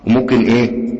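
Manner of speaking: usual speaking voice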